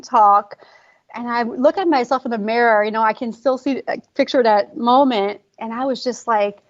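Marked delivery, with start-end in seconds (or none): none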